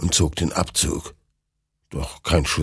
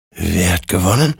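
ordinary talk, nothing marked

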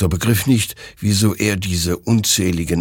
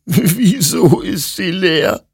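high, slow, loud anguished wail; sobbing; 12 semitones higher, 5 dB louder, slower than usual. A narrator's reading. Hektor finds the gun empty, sobbing. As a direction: sob the question out, drawn out. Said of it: "schluchzte Hektor".

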